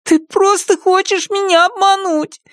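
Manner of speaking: put-on very high, upset child voice; squeaky, worked-up child; agitated little boy. A narrator's reading. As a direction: accuse in a small boy's high voice. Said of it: "Мальчик разволновался".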